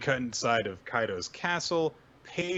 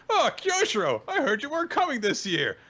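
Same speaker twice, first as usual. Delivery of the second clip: character voice; 3 dB louder than usual. Retold or imitated. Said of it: "and it's like".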